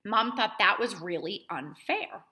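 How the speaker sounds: ordinary delivery, nothing marked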